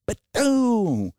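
a high, punchy "ba-doom" for a door slammed open; door-kick sound. Retold or imitated. Kicking open the office door, high imitated bang.

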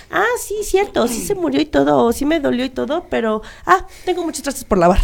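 high, airy mock-casual brush-off; lightly voiced dismissive mourner. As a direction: mimic someone shrugging off grief to do dishes. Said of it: "Así como de".

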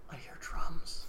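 hushed, voiceless wondering; eerie whisper. Retold or imitated. Imitating Alan hearing the game's drums, eerie whisper. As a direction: whisper it as if catching a distant sound. Boy hears mysterious drums.

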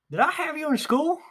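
high, cheery rising question; imitating the teacher. Friend imitates the old teacher asking everyone, high and cheery. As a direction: mimic his favourite line. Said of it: "this is his key word".

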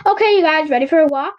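rally the dogs for a walk; calling the dogs. A narrator's reading.

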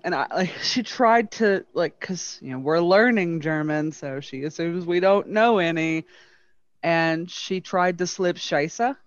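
ordinary talk

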